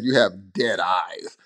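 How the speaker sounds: low, slow, deadpan Tom Hanks. Retold or imitated. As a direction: drop low and slow, dead serious. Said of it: "We can't imagine Tom Hanks having said".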